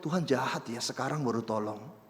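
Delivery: soft low grumbling whisper to God. Retold or imitated. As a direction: murmur it, a touch resentful. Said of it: "kita masih bisikin Tuhan".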